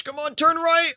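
high-pitched, strained urging; will the boat to turn; urgent pleading. Real, not acted. Gamer fighting to steer, urgent pleading.

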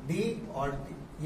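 very slow, each part drawn out; teacher spelling out d theta by d t; slow spelled-out reading. Shown as a demonstration. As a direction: dictate it slowly. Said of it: "ये ऐसे पढ़ेंगे".

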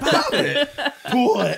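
high, loud laughing toy voice; Bop It toy voice. Pretend. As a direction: squeal the commands like the toy.

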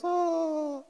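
a high, startled "Oh" like a deer in headlights; stunned little gasp. Retold or imitated. Terrified freshman caught off guard, stunned high oh. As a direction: freeze up and squeak it. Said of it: "just like this".

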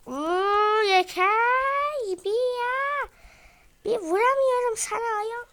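high, loud frustrated whining; frustrated whine. Real, not acted. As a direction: complain loudly at the bear. Frustrated at bear. (yüksek perdeden sinirli sızlanma)

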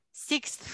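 sixth breathed with a clear ks then th; voiceless sixth showing both end sounds; whispered demo. Shown as a demonstration. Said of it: "El sexto tiene que sonar la X".

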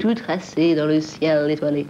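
mock solemn. Mock mystical answer about destiny.

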